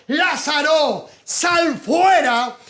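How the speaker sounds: llamado lento, agudo y fuerte; voz de trueno. Acted. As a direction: ordenar con voz de trueno, estirando cada palabra. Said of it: "gritó con voz de trueno". (Jesús ordena a Lázaro salir de la tumba, gritando)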